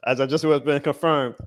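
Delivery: ordinary talk, nothing marked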